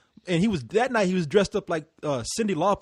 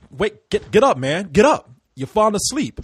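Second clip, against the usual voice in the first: louder, urgent "get up"; imitating a wake-up call; 3 semitones higher, 6 dB louder than usual. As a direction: rouse a dozing friend, sharply louder. Wakes up a sleeping friend.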